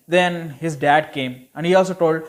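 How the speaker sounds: usual speaking voice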